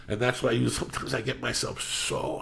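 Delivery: ordinary talk, nothing marked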